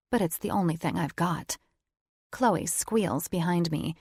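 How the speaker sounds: ordinary delivery, nothing marked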